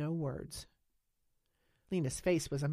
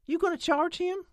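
much higher, louder, startled; surprised question; 8 dB louder than usual. A narrator's reading. Holly asks Lena about pressing charges, startled. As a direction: ask it amazed.

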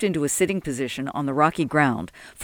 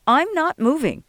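high-pitched, defiant refusal; stubborn refusal; 11 semitones higher than usual. A narrator's reading. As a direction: refuse to budge, voice raised in pitch. Sol refuses to budge.

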